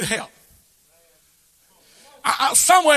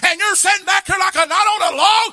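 high, loud shouted sermon; full-force preaching; 7 semitones higher, 3 dB louder than usual. Acted. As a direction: thunder the rebuke at the parents. Preaching climax.

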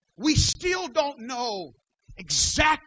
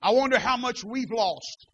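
usual speaking voice

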